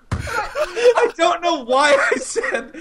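high, squealing through laughter; slow words broken by real laughs; helpless laughter. Real, not acted. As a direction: struggle to speak while cracking up. Cracking up at own embarrassing line.

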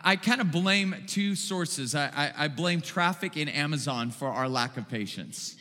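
normal talking voice

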